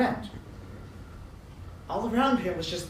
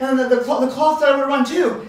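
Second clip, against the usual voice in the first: high, loud stammering blurt; flustered report; 5 semitones higher, 7 dB louder than usual. Acted. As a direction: trip over the start, then blurt it. Draper adds his panicked report.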